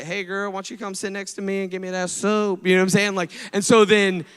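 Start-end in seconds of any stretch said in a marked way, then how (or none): 0.0-2.7 a hint of a smooth sleazy flirt; lightly voiced pickup line; mock flirty come-on. Brother coaxing his sister onto the bed, mock flirty. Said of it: "he's sitting on the bed like".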